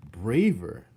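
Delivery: usual speaking voice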